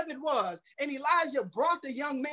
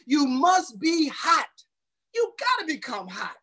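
preaching shout; 4 semitones higher than usual. Acted. Preaching climax.

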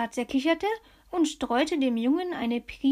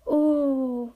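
plain reading voice

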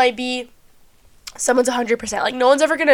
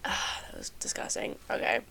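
real whisper after clearing nose; 15 dB quieter than usual. Real, not acted. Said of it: "I don't know why I sound so fucking stuffy".

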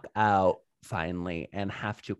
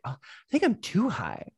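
pretending to be high; 7 semitones higher than usual. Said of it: "kind of pretending like".

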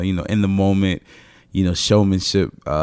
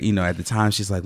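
ordinary talk

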